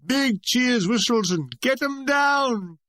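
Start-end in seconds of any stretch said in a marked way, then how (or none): 1.6-2.8 high, drawn-out catcall; rowdy heckle. A narrator's reading. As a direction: bawl it from the back row. Audience heckles the dancing twins.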